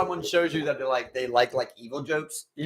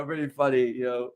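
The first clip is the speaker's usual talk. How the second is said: usual speaking voice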